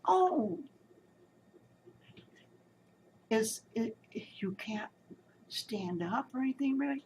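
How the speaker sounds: high, mock-sweet doctor voice, dropping to a hush; hammed-up fake concern; wife mocking the doctor's question. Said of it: "so-called doctor".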